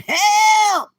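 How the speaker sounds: high, loud hammed-up cries for help; comic pleading scream for water; mock liver screaming. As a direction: play a desperate liver begging for rescue. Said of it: "Your liver's over there screaming".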